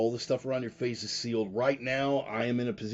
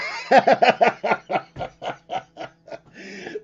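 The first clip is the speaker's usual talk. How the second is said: high laugh; 12 semitones higher, 9 dB louder than usual. Real, not acted. Laughing at own joke.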